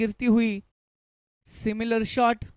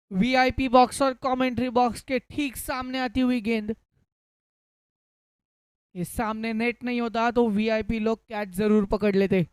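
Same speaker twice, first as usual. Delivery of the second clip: hindi cricket commentary. Real, not acted. Live cricket play-by-play.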